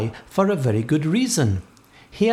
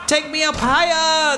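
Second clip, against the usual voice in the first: ordering; 12 semitones higher than usual. A narrator's reading. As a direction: call the order up high, stretching it. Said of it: "the captain ordered".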